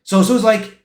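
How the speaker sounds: ordinary delivery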